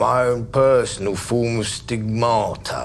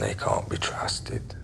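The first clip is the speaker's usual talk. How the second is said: menacing hush; 12 semitones lower, 8 dB quieter, slower than usual. Acted. Menacing the visitor.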